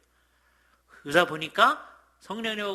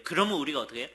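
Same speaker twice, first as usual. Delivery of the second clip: normal talking voice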